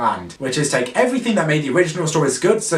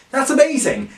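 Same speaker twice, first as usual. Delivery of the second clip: sarcastic gushing, pitched up; mock excitement; 9 semitones higher than usual. Reviewer gushing sarcastically over the movie's premise. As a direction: fake enthusiasm over a dumb idea.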